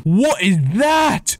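high, drawn-out exclamation; astonished. Real, not acted. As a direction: stretch it out in high-pitched astonishment. Startled by sight in game.

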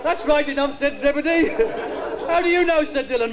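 put-on high-pitched kids' TV storyteller; mock children's story narration. Comedian reading a Magic Roundabout script, childlike storytelling voice. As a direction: read it high and simple, as if to little children.

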